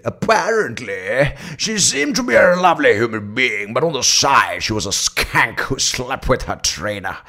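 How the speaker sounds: high, slow, drawn-out gossip; put-on gossip voice. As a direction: dish the scandal in an affected voice. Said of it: "how many of you would say it".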